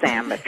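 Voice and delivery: "sandwich" said as SAM-mitch; regional pronunciation. Shown as a demonstration. Guest teasing the careful host, "sandwich" as sammich. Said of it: "you're not a sandwich person".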